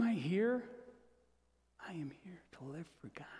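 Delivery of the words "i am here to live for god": quick soft whisper; whispered fast confession. Real, not acted. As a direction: breathe it out fast. Answer to why am I here.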